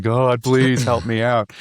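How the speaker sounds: slow, half-mocking plea to God; pleading prayer. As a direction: beg God for help, drawn out. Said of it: "the conversation like".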